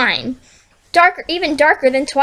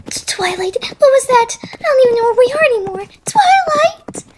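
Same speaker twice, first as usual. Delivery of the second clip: frightened calling; 12 semitones higher, 3 dB louder than usual. Acted. Lost frightened pony calls out.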